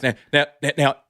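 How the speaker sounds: imitating a boss rushing to calm him down; panicky producer. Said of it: "panic tone in his voice".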